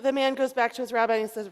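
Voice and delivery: usual speaking voice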